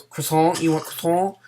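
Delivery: a clumsy mock French waiter; badly faked French accent offering a croissant. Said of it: "that was a very bad French impression".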